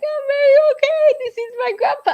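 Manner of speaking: high, loud voice cracking into tears; crying while insisting she's fine; tearful. Retold or imitated. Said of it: "I start crying".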